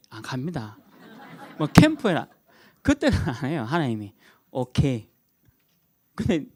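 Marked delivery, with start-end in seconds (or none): none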